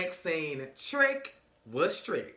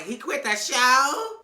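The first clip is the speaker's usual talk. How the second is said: higher, louder, slow disbelieving question; shocked question; 7 semitones higher, 5 dB louder, much slower than usual. Retold or imitated. Bobby asks Joy about Trick quitting, slow and shocked. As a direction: stretch it out in disbelief.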